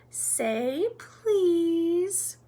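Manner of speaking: high, drawn-out teasing lilt; annoying sing-song. A narrator's reading. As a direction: stretch it out to needle him. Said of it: "in his annoying sing-song voice".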